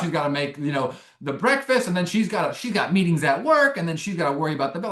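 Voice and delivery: normal talking voice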